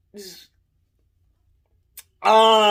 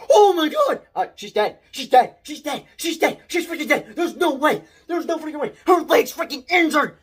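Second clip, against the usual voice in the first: panicked disbelief; 7 semitones higher than usual. Retold or imitated. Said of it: "I'm like".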